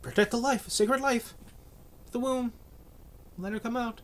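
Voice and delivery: high, sing-song mock piety. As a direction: parody the slogans in a high voice. Mocking pro-life slogans.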